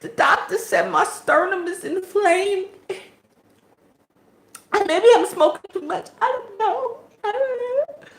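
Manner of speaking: high mock sob-whine; high mock crying whine. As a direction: mimic her teary complaint high. Mimicking her complaint.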